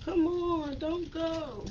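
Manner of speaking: high pleading coax. Real, not acted. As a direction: beg it to stay put. Piece slipping out while crafting.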